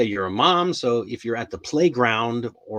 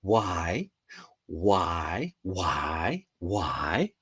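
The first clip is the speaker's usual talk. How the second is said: lower, dragged-out repeated why; son's endless why; 5 semitones lower, 4 dB quieter, much slower than usual. Retold or imitated. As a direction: whine it like a stubborn little boy. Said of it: "my son's favorite question is".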